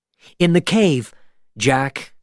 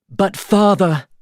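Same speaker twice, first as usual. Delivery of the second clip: high, loud, slow plea; pleading shout; 9 semitones higher, 4 dB louder, slower than usual. A narrator's reading. Elizabeth begging her father to spare Jack, loud plea. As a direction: cry out to stop her father. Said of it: "shouted Elizabeth".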